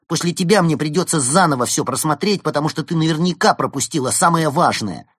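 loud, high-pitched squabble; heated bickering. A narrator's reading. Fox and penguin quarrelling over newspapers, heated. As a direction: argue back indignantly.

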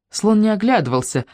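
ordinary delivery

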